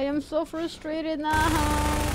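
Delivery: high, slow whiny moan; mock whine. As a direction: drag it out like a mock sob. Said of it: "I'm gonna cry".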